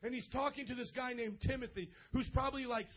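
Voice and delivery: ordinary talk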